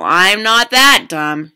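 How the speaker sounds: loud, slow, emphatic denial; knowing refusal. A narrator's reading. Girl refusing the fish's candy bait, knowingly emphatic. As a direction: draw it out, not to be fooled.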